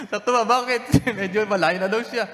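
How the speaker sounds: high, laughing through the words. Real, not acted. Pastor laughing as he retells the widow story.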